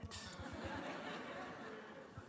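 a soft unvoiced laugh; a breathy chuckle after the story. Real, not acted. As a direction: laugh quietly at the memory. Laugh after mother's line.

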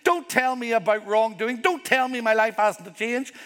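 defensive protest. Retold or imitated. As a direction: push back like a resentful listener. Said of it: "when they say".

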